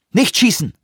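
high-pitched urgent command; urgent warning call. A narrator's reading. Lee stops the soldier from firing, urgent call. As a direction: call out to stop the shot.

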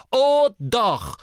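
high, loud contradiction; furious. A narrator's reading. Brother contradicts his sister, furious. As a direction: shout it back in anger. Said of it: "erwiderte Ron genauso zornig".

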